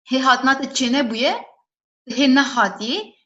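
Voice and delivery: usual speaking voice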